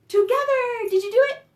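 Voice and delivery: high, loud burst of excitement; triumphant call. Real, not acted. Triumphant call after clasping fingers.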